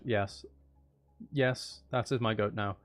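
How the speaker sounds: ordinary delivery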